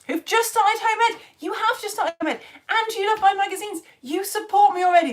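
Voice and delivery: high-pitched, gushing delight; thrilled welcome. Real, not acted. Teacher praising newly home-educated viewers, thrilled welcome. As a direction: gush high at brand-new supporters.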